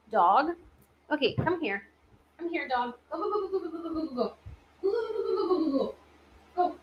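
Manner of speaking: softly, high-pitched, shooing the dog away; quiet high coaxing go go go; hushed dog commands. Real, not acted. Calling the dog off camera.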